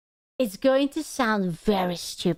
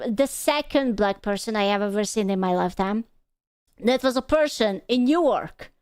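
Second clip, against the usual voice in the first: ordinary delivery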